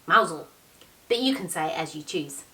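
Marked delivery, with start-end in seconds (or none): 0.1-0.5 Mousehole said as MOW-zul, two syllables, the h dropped; Cornish pronunciation. Shown as a demonstration. Narrator showing listeners the local village name, Cornish pronunciation. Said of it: "They say it in the Cornish way".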